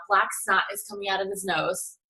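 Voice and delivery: ordinary delivery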